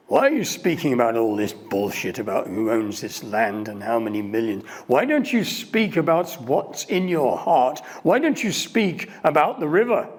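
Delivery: loud, intense pleading voice; passionate heckler. Retold or imitated. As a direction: challenge him to speak from the heart. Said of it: "this voice calls out".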